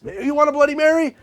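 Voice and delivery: chirpy pranking bartender; mock bartender. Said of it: "maybe it's a prank".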